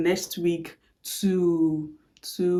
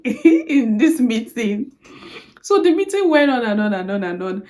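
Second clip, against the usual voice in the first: laughing; 3 semitones higher, 5 dB louder than usual. Real, not acted. Laughs while telling.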